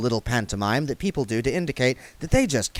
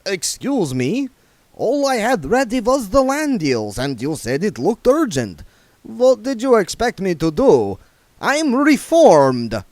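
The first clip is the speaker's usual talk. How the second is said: higher, louder, offended; vampire defending himself to the dwarves; indignant vampire Otto; 11 semitones higher, 5 dB louder than usual. Acted. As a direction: protest his innocence haughtily.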